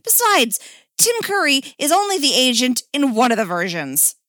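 high, loud objection; protesting. Acted. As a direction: argue the point indignantly. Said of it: "Dick protested".

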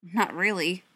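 tight, restrained retort; through gritted teeth. A narrator's reading. Ryan answers Beckett's taunt, through gritted teeth. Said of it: "Ryan said through gritted teeth".

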